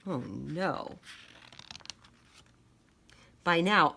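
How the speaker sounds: plain reading voice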